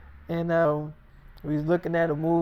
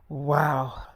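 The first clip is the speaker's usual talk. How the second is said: breathless whispered "Wow."; a whispered wow in awe; 3 semitones lower than usual. Retold or imitated. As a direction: whisper it, still stunned.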